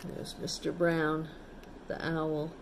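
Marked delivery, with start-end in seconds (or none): none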